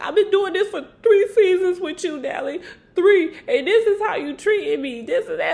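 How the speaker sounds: hammed-up hurt outburst, half laughing; mock upset yell. [name] yelling at Natalie about three seasons, in a hammed-up, half-laughing outburst.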